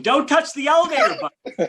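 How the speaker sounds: high, loud comic warning; hammed-up horror-viewer's yell. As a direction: shout it in mock alarm at the screen. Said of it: "now I'm yelling".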